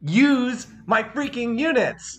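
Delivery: cracking with real fury; angry, voice cracking. Real, not acted. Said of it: "my voice is cracking 'cause I'm so angry".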